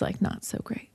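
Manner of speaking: whispered aside. Real, not acted. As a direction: drop to a whisper. Sheepish admission.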